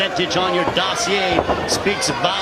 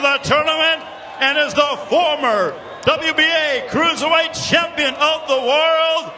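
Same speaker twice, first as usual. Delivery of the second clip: lucha libre announcer; 5 semitones higher than usual. Acted. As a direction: stretch the names, hype the crowd. Ring announcer, Spanish intro.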